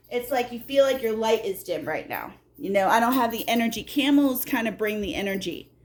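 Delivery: ordinary talk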